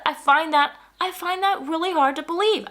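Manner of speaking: high-pitched incredulous retort; hard-to-believe protest, pitched high; exasperated disbelief. Retold or imitated. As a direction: let the disbelief push the voice way up. Retelling what she told the neighbor.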